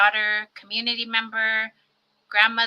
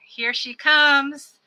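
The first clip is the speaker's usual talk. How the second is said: announcer build-up; 5 semitones higher, 6 dB louder, much slower than usual. Acted. Introducing the co-host onto the stream.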